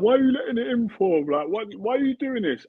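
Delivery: 울먹이며 칭얼대는 아이 흉내; childish crying whine. Retold or imitated. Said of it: "I'd be crying".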